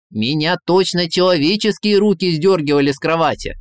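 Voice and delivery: put-on high, loud, fretful old ruler's lament; excitable tsar, aggrieved. Tsar tells Ivan of his kidnapping, excitedly aggrieved. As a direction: pour out the ordeal in a high, loud voice.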